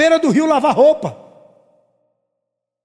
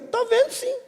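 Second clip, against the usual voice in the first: mock mother retort; 12 semitones higher, 6 dB quieter than usual.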